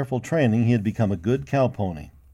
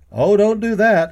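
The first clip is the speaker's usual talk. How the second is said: high, loud alarmed protest; exclaiming; 10 semitones higher, 9 dB louder than usual. A narrator's reading. Cowboy begging the boy not to tell the cook, loud exclaim. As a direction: stop him in a hurry. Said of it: "exclaimed the cowboy".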